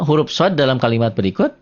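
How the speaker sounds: usual speaking voice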